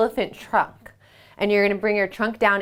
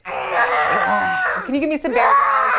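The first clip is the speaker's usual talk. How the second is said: playful shouted bear roar; slower than usual. Shown as a demonstration.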